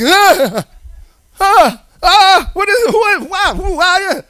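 high, loud mock fright; startled shepherds. Shepherds seeing the angel, hammed-up high fright. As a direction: jump back in fake panic. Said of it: "And they're like".

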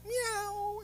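high, soft little meow; a sing-song mock cat; mock kitty meow.

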